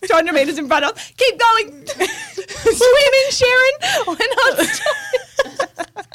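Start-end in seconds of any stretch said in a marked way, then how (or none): 1.2-1.9 higher, louder call from far ahead. Retold or imitated. Imitating Kate calling from the water ahead, high calling. 1.9-6.0 high laughing call, slow and drawn out; laughing mock call. Imitating Kate shouting to the swimmer, high laughing call.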